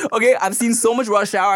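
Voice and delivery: ordinary delivery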